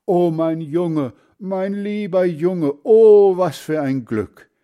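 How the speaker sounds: high, loud cries of joy; a swelling, emotional "oh" at the peak; overjoyed relief. A narrator's reading. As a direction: stretch the words out with overflowing relief. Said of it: "er sagt dabei dauernd".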